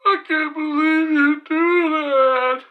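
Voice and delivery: high, loud, drawn-out wail; mock crying. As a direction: imitate a wounded blubbering complaint. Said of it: "This motherfucker was crying".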